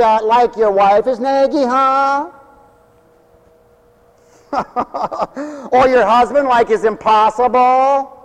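hammed-up Valley Girl voice; Valley Girl talk. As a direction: tease the congregation like a Valley Girl. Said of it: "people sound just like the valley creatures".

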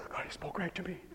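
hushed whisper, pretending to be awestruck; mimicked awed whisper. Preacher mimics people who take it personally, stage whisper. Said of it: "people take that and say".

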